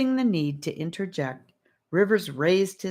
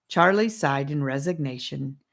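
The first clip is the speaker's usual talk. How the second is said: ordinary delivery, nothing marked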